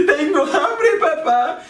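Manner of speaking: put-on high, whiny child's complaint to dad; whining little daughter. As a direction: whine it like a hungry little girl. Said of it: "una hija se queja y dice".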